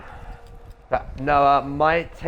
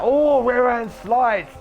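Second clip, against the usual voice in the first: excited; 10 semitones higher, 6 dB louder, much slower than usual. Real, not acted. Crash call in race commentary.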